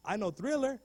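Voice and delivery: ordinary talk, nothing marked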